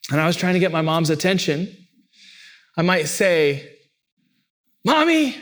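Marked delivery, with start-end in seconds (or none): 4.8-5.4 calling mommy. Retold or imitated. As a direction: call out for mom like a kid. Child calling for mom.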